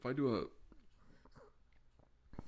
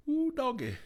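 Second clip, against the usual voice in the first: high, loud yelp of surprise; surprised; 5 dB louder than usual. Real, not acted. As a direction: whoop at the strong taste. Reacting to a strong drink.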